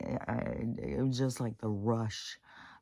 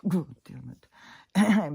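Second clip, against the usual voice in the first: drawn out, loud and higher; real exasperation breaking through; a slow, strained curse; frustrated outburst; 6 semitones higher, 7 dB louder, much slower than usual. Real, not acted. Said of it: "I'm still very emotional about this".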